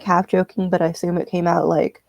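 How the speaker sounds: usual speaking voice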